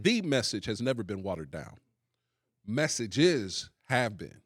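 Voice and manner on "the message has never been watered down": emphatic "The", pronounced "thee" before "message"; stress on THE. Shown as a demonstration. Preacher repeating his point for the panel, stress on "The". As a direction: repeat it firmly, leaning on "The" as "thee". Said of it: "say it one more time with".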